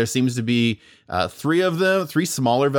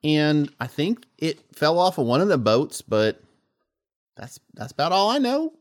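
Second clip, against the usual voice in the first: the GM's fish answering the diver, a bit higher and southern; lightly southern fish voice; 5 semitones higher than usual. Acted. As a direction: keep the flounder's folksy Southern voice going. GM still voicing the fish.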